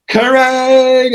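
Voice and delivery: high ringing shout of a guitar chord; guitar crash shout. Retold or imitated. Rock writers answering the phone, high shouted guitar-crash noise. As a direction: belt it like a power chord. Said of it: "Why don't we call it".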